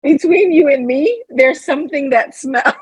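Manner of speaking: high punchline breaking into laughter; giggly punchline; laughing punchline. Real, not acted. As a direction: deliver it, cracking up.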